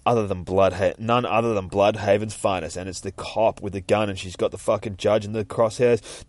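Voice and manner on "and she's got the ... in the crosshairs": plain reading voice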